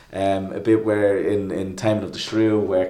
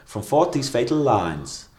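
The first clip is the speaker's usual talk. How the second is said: slow, posh English reading; posh standard English pronunciation; posh English accent; 3 semitones higher, slower than usual. Shown as a demonstration. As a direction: say LINES in a posh English accent, with a clean "eye" vowel. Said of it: "in the posh kind of dialect, they say".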